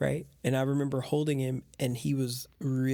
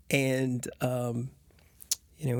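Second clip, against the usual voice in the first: usual speaking voice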